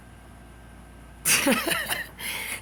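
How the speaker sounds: a sudden high airy laugh; high breathy laugh. Real, not acted. Laughing at his comment, high and airy.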